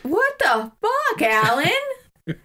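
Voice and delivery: high, shocked cry of disbelief; mock outraged cry. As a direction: squeal it in fake horror. Mock outrage at Alan's plan.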